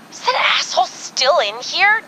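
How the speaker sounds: can't believe he's still here; shrill outraged question; indignant disbelief. Acted. S2 indignant at diner.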